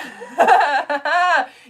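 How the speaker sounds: big high burst of laughter; loud laugh. Real, not acted. Host cracking up at viewer's picture, loud laugh.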